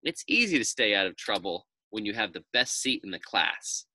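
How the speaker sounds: usual speaking voice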